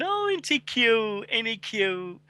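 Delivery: high, drawn-out cooing in mockery; high-pitched mock adoration; mock gushing. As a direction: imitate people fawning over a cute photo. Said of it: "everyone's going".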